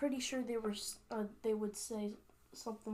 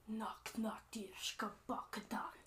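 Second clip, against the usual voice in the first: rough whispered threat, softer; menacing orc voice; 6 dB quieter than usual. Acted. Orc leader crushes skull before the Highlander, whispered threat. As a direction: rasp it quietly at the enemy.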